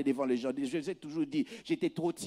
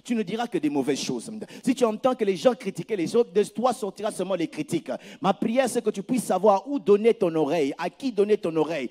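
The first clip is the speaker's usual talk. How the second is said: loud, insistent sermon warning; full-force preaching; 8 dB louder than usual. Acted. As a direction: press the warning home, preaching. (prédication forte et insistante)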